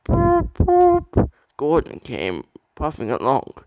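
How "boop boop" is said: high, loud whistle call boop boop; high tooting engine whistle. A narrator's reading. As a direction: sing it out like a train whistle. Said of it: "he heard a whistle".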